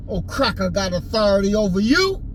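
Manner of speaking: usual speaking voice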